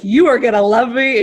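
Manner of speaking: playfully bossing the coach; playful insistence. Retold or imitated. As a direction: tease him, bright and loud. Teasing coach with affection.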